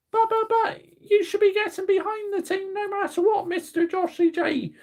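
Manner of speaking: squeaky high-pitched mock heckler voice, stammering but but but; whiny critic. Imaginary viewer scolds Joshy for negativity, in a squeaky mock voice. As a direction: play a nagging fan scolding him in a silly high voice.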